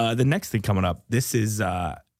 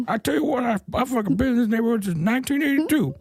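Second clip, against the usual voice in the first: higher cranky get-off-my-lawn voice; grumpy old neighbor; 10 semitones higher than usual. Acted. Old neighbor shooing kids off his lawn, cranky. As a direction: yell at the kids from the porch. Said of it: "you sound like an old neighbor".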